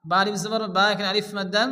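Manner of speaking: ordinary talk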